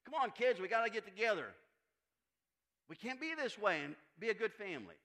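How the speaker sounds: put-on rally the squabbling kids, loudly; exasperated parent. Parent to kids about getting together, exasperated urging.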